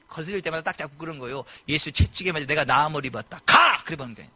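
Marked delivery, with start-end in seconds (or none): 1.7-3.8 commanding declaration. Retold or imitated. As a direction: bark the final 가 like an order. Commanding the bone to go.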